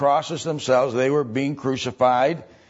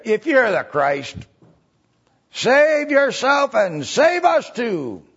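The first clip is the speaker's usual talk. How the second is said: high, slow, jeering taunt, louder; mocking rail; 9 semitones higher, 4 dB louder, slower than usual. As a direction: sneer it out to mock the dying man. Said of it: "The Bible says he railed on him".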